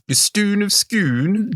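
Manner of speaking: high, slow, hammed-up Scottish accent; mock Scottish accent. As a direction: drawl it in an over-the-top Scottish accent. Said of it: "It's very offensive every time you do that".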